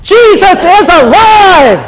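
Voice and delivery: "Jesus is alive" shouted high and loud, "Jesus" hit hardest; shouted acclamation. Shown as a demonstration. Preacher leading the congregation's response, shouting it. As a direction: belt "Jesus is alive" to raise the roof. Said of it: "This side of the congregation is going to say".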